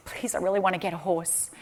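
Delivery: soft, understated begging; hushed plea. Retold or imitated. Speaker as a child begging for a horse, hushed plea. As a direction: plead softly, under the voice.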